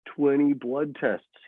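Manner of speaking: slow, flat-out unimpressed twenty blood tests; patient boy shrugs off her story, unimpressed. Acted. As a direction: drag it out, bored. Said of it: "He still sounds unimpressed".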